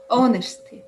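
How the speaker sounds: "Honesty" begun on an open O vowel; silent H. Shown as a demonstration. Student repeating for the teacher, "Honesty" with no H sound. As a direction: leave the H off "Honesty". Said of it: "that H is silent".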